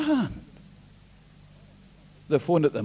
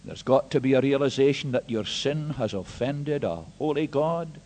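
usual speaking voice

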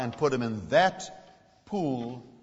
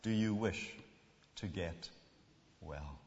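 tender; 8 semitones lower, 12 dB quieter, slower than usual. Acted. Tender question to listener.

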